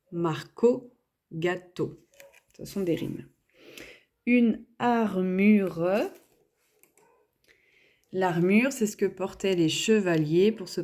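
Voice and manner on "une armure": very slow, drawn-out dictation; dictation pace. Shown as a demonstration. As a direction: stretch every sound so it can be written. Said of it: "dictating words to the class".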